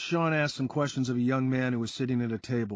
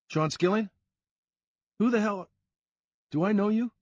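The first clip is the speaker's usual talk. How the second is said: put-on higher, louder suspicious young guy; wary Sean; 9 semitones higher, 4 dB louder than usual. A narrator's reading. Sean challenging a stranger outside, higher and louder. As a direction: demand who this is.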